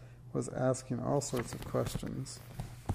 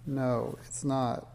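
usual speaking voice

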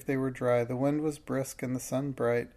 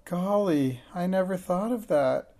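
higher, startled realization; dismayed; 6 semitones higher, 4 dB louder than usual. A narrator's reading. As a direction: catch yourself in sudden dismay. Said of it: "her eyes round with horror".

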